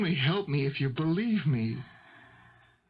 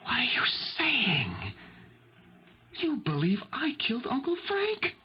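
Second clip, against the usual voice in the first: stunned, higher whisper of disbelief; shocked whisper; 6 semitones higher than usual. Acted. Son realising his mother thinks he killed, whispering. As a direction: breathe it out in horror. Mother doubts son's innocence.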